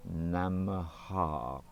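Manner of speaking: "Namaha" said slowly and evenly. Shown as a demonstration. Teacher listing mantra parts, slow Namaha. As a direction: draw out "Namaha" calmly. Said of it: "followed by".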